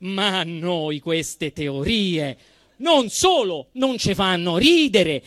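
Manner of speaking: normal talking voice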